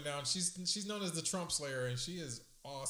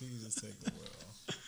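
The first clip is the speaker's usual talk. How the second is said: low slow voice; 7 semitones lower, 7 dB quieter, slower than usual. Pretend. Quoting the song title.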